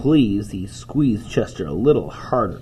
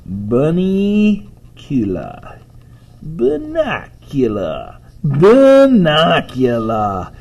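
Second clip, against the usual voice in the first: high, loud, very drawn-out name; grand spooky reveal; 6 semitones higher, 6 dB louder, much slower than usual. Acted. Mother announcing the compromise name, grand drawn-out flourish. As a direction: stretch each syllable theatrically, building louder.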